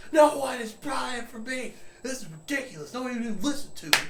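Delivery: high-pitched imitation of an outraged salesman; mock indignant yelling. Rebuffed bug guy yelling at the wife, mock outrage. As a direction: ham up a tantrum, pitch way up. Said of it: "He yelled at her".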